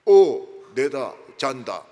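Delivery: loud, slow Gyeongsang dialect; 느리고 큰 경상도 사투리; gruff Gyeongsang reply. Retold or imitated. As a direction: drag out a blunt Gyeongsang goodnight. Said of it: "끊어버리고 말이지".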